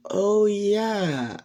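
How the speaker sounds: high, drawn-out realization; sudden recollection. Acted. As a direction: stretch it out as it dawns on you. Character suddenly remembers third person.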